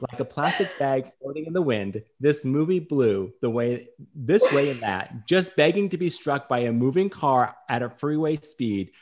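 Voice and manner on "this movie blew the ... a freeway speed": usual speaking voice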